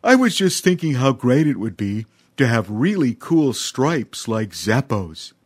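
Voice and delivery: dreamy, slowing elephant voice; long for stripes out loud; wistful young elephant. A narrator's reading.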